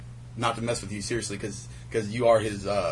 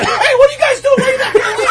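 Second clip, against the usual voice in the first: very high, loud yelling woman; shrill woman yelling; 12 dB louder, faster than usual. Retold or imitated. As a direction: screech it like an angry woman. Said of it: "she comes out like".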